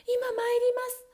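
high, slow, timid reply; wife stalling her husband; wife answering. Acted. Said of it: "と奥さんは答えました".

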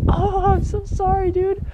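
high, apologetic put-on sister; a lightly voiced sheepish sister; mock sister voice. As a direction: play the sister, overly sorry and high. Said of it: "she probably didn't talk like that".

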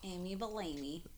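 off-mic, distant and faint; away from the mic. Real, not acted. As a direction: speak away from the microphone. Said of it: "talking to the mic".

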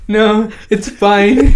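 high, drawn-out imitation of a scared kid; mock timid reply. Scared kid brushing off an apology, mock timid. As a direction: squeak out a slow, hammed-up reassurance.

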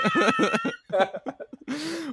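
high squealing laughter; high shrieking laugh. Real, not acted. Sibling shrieking with laughter at the memory.

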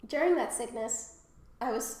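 ordinary talk

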